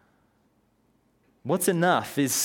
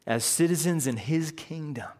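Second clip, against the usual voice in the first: ordinary talk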